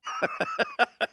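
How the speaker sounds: high, breathy burst of laughter; villain chuckle. Acted. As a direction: let out a short villainous laugh.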